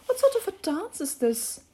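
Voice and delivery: put-on high girl voice, questioning; high-voiced curious girl; Alice asking. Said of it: "said Alice".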